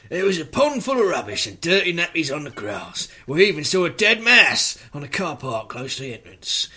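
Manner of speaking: hammed-up Cockney reading; Cockney accent. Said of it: "in the Cockney accent".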